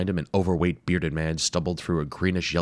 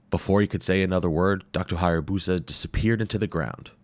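plain reading voice

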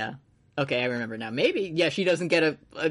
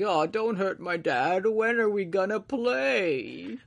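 put-on slow, drawn-out eerie little girl voice; pitiful chimera girl; 3 semitones higher, slower than usual. Nina monster voiced in recap.

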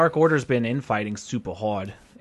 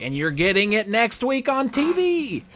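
announcer voice; 8 semitones higher than usual. Retold or imitated. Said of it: "they're like".

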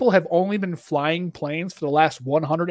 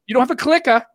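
CLICKER as "clicka" in a Boston accent; higher, Boston-accented CLICKER with the R dropped; 7 semitones higher, faster than usual. Shown as a demonstration. Teasing relatives about old TVs, in a Boston accent. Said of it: "that's my Boston accent".

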